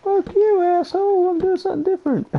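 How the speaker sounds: very high, playful protest; mock-indignant squeal. Player retorts to a teasing friend, mock-indignant squeal. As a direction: squeal it back in mock outrage.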